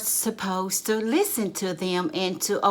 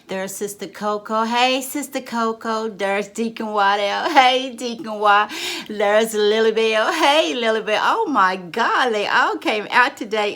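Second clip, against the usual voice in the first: delighted greeting; 3 semitones higher, 5 dB louder than usual. Acted. Pastor spotting members at Bible study, delighted. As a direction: call out to each arrival with delight.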